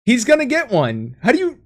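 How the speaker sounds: can't believe what he's hearing; incredulous protest. Real, not acted.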